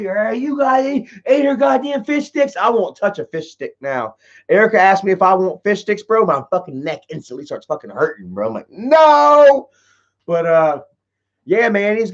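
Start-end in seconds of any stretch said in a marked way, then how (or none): 0.0-2.6 angry dad. Retold or imitated. Dad choking him, yelling. 8.7-9.7 high, loud retold shout of refusal; mock yell. Telling viewers how he refuses fish sticks now, a loud yelp.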